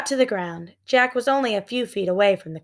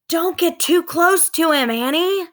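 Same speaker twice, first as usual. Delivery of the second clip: higher urgent warning call; shout; 9 semitones higher, 3 dB louder than usual. A narrator's reading. Jack shouting at Annie near the pterodon. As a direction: warn her off from a distance. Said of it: "Jack shouted".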